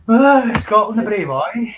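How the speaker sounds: high, loud, hammed-up patriotic cheer; sarcastic mock cheer. Mocking the Irn-Bru Scots, a sarcastic high loud cheer.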